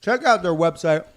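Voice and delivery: ordinary talk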